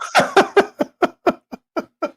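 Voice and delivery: breathy high scoff of a laugh, no voice; disbelieving laugh. Real, not acted. Host scoffs at the senator's eighty billion figure, incredulous laugh.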